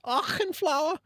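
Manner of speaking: high, loud exaggerated German accent. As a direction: belt it out in a hammy German accent. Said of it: "really racist German accent".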